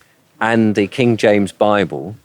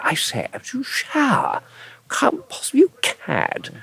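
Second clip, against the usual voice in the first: high, soft posh cad voice; Terry-Thomas impression; 7 dB quieter, slower than usual. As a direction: put on a plummy old-film toff. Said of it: "that era with Terry Thomas".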